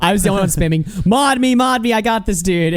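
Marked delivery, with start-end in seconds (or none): 1.1-2.7 mock chat spammer. Mocking own chat spam.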